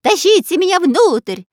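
high, loud, urgent yell; shouting. A narrator's reading. Robber hanging outside the aircraft, shouting to friends. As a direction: yell to be hauled in. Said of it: "кричал разбойник".

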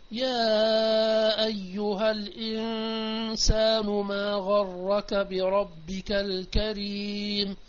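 very slow, intoned recitation; chanted verse addressed to mankind; Quran recitation. Acted. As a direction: draw each word out as in tilawa. Recites verse in tafsir lesson.

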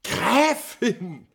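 aghast, shrill protest; shocked outcry. Acted. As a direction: cry out in alarm. Marinelli aghast at the accusation.

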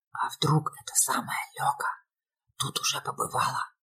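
hushed, eerie whisper; ominous whisper. A narrator's reading. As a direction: whisper it like a ghost story. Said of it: "зловещим шёпотом спросил Зубов".

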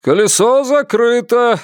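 high-pitched proprietor's announcement; announcing. A narrator's reading. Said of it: "объявил он и для верности повторил".

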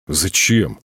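plain reading voice

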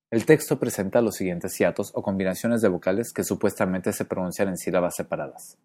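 usual speaking voice

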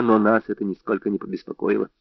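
usual speaking voice

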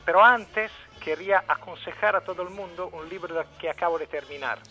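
ordinary delivery

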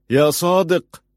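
loud, high call to his brother; shouting his name. A narrator's reading. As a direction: cry out for your brother at full voice. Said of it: "صاح بأعلى صوته".

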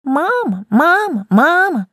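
high shrill mocking imitation; shrill mocking hag. A narrator's reading. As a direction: mimic the kitten in a screechy old hag voice. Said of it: "тонко и визгливо передразнила". (тонкое визгливое передразнивание)

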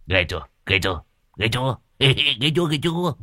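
repeated boast climbing to high pitch; show-off fishing for admiration; gloating. Acted.

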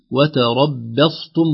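slow "وتربصتم" with thin "bae" between heavy letters; light ba. Shown as a demonstration. Sheikh models correct reading, "وتربصتم" with light ba. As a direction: keep the doubled ba of "وتربصتم" thin. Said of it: "ترقيق الباء بين المفخمين".

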